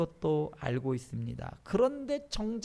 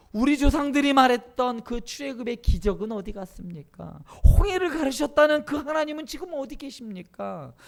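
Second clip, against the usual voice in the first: raised, pressing questions to God; Gideon's challenge; 6 semitones higher, 6 dB louder than usual. Acted. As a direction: protest with pitch climbing high. (높아진 목소리로 하나님께 따져 묻기)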